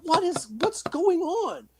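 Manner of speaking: high, slow alarmed what is wrong; alarmed question. Retold or imitated. Asking a startled friend what he saw, high and slow. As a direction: voice jumps up in mock alarm. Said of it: "I'm like".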